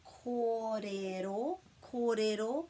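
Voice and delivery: "Kōrero" then "kōrero mai" drawn out slowly; slow word by word. Shown as a demonstration. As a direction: build the phrase piece by piece. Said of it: "towards the speaker".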